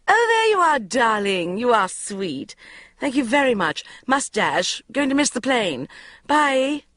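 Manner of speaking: high, loud breathless thanks; mother snatching the cash; gushing mother. A narrator's reading. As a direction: grab and go like a flighty mum. Mother voiced, gushing and hurried.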